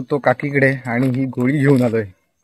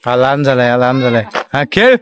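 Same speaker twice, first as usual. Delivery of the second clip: shouting across a distance; 8 semitones higher, 6 dB louder than usual. Real, not acted. Calling across to kids playing cricket at dusk, loud shout.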